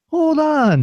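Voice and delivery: very high, mock-astonished voice; imitated drive-through girl. Drive-through girl stopping at the sight, high imitated voice. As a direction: put on a high girl's voice, stalling. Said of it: "And she's like".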